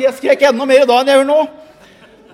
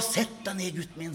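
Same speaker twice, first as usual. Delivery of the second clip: put-on old woman soothing; 8 semitones lower, 19 dB quieter than usual. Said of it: "sa hun".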